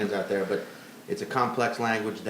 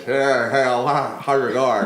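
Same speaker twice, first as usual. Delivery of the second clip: loud goofy greeting voice; new goofy voice; 5 dB louder, slower than usual. Acted. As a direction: greet in a silly put-on voice. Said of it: "here's my new voice".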